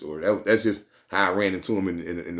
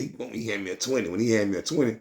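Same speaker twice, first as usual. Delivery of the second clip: normal talking voice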